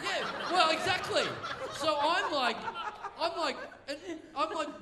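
laughing through the words; giggling as he tells it. Real, not acted. Laughing through a story told to mates.

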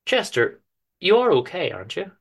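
fond wife, mildly critical; lightly voiced wife. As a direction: coax him kindly as his wife. Penny checks on him.

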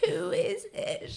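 put-on high, slow, quavery old lady; old person voice. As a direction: play a doddery old caller. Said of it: "you could do an old person voice".

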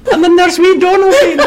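very high, loud, squeaky little prince; comic squeaky voice. Pretend. Forgotten second son announcing himself, loud comic squeak. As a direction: pipe up in a silly falsetto. Jokey Donalbain butting in again.

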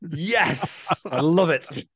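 high, loud delighted burst; delighted yes. Real, not acted. Co-host loving the jukebox joke, delighted high outburst. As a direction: cheer the idea with real delight.

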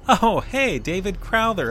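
high, loud surprised greeting. Acted. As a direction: act caught off guard. Feigned surprise at guest arriving.

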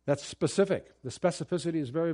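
ordinary talk, nothing marked

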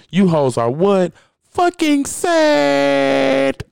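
high, very slow, crying drawn-out whine; Mimi voice. Retold or imitated. Mocking viewers who waste their lives, in a high slow crying whine. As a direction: stretch it into a high mock-weepy wail. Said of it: "In my Mimi voice from Love and Hip Hop Atlanta".

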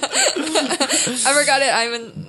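high, drawn-out, through laughter; laughing mock confession. Comedian replaying own flub to co-host, laughing helplessly. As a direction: say it slowly while cracking up. Acting out a botched sketch pitch.

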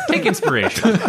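squeaky mocking voice; high mocking impression. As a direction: mimic him in a silly high voice. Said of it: "he's just like, "David".

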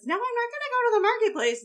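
high, loud, rushed whiny protest; petulant son's refusal. Retold or imitated. Acting out a mother-son quarrel.

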